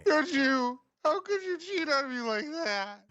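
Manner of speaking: high, wailing soap-opera betrayal; melodramatic heartbroken lover. Pretend. Mock soap breakup scene.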